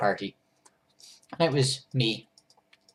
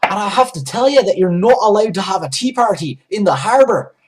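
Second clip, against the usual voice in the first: higher, louder scolding guard; angry guard; 8 semitones higher, 10 dB louder than usual. Retold or imitated. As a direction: lay down the law, indignant. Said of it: "seemed really angry".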